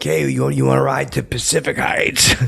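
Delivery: loud, high call from a passing car. Retold or imitated. To Mike Keaton jogging by, a loud teasing call from the car. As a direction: shout it out the car window.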